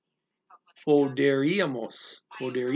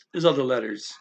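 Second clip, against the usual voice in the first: ordinary talk, nothing marked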